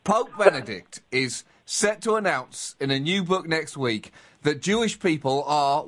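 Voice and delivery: normal talking voice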